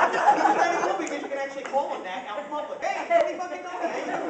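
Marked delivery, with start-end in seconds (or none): 2.8-4.3 higher, louder greeting; calling out. Retold or imitated. Greeting a cousin out in public, called out. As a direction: call him across the street.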